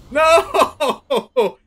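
high, loud yelp of disbelief; real shocked cry at a bad draw. Real, not acted. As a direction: yelp it out, caught off guard and laughing. Opponent draws instant removal.

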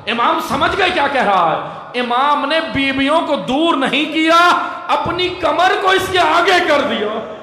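high-pitched, mounting lament; impassioned majlis preaching. Acted. Orator telling mourners how the Imam offered his back, impassioned lament. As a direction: raise the voice steadily toward a cry.

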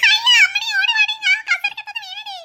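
high panicked call to a friend; yelling for her friend after falling; calling for help. Acted. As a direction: shout across the room for help, rattled. Fallen into chair, calls friend.

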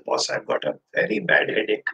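quiet, whispered sick complaint; weak whisper. Retold or imitated. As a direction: whisper it like you're unwell. Friend complaining of a headache.

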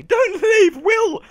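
high, loud squeal of protest; mock desperate plea. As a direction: beg in hammed-up dismay. Pleads with departing character.

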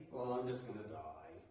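put-on low, soft, self-pitying old-person voice; mopey old person. Pastor imitating a gloomy elderly person. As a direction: droop it like a gloomy old-timer. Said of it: "You ever talk to anybody like that?".

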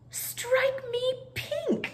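Mary pleased at the tea table; pleased exclamation. Acted. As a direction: savour each word with glee. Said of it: "what she always said when she was pleased".

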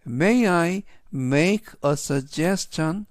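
slow, careful English question; slow model sentence. Shown as a demonstration. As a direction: read it slowly for learners. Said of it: "전체적인 의미는". (천천히 또박또박 읽는 영어 질문)

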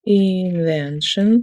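Invention drawn out very slowly while writing; dictating Invention slowly to the class; slow dictation. Shown as a demonstration. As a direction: spell out Invention at a crawl. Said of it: "let's write".

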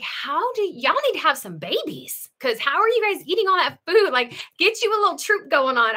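mock-nag them to have babies; imagined nudge to the couple. Talking to a gardening couple, playful high teasing. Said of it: "part of me is like".

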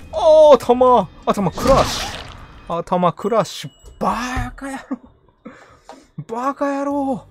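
loud high yell after a hit; hit hard crying out. Real, not acted. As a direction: shout it out in pain and anger. Player hit, cries out in game.

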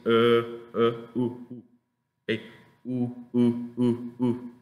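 short relaxed "u", as in could; lax U sound. Shown as a demonstration. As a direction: repeat "u" loosely without rounding fully. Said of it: "eso se pronuncia así".

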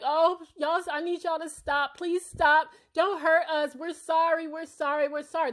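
high pleading mock sob; mocking a crying girl's plea, pitched high. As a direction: imitate a scared girl begging through tears. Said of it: "Now she's crying like".

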